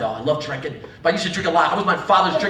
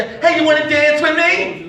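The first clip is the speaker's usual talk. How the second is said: higher, drawn-out invitation; mock drunk suitor; 6 semitones higher, slower than usual. Drunk comic asks woman to dance, mock eager. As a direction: ask with slow, hammed-up eagerness.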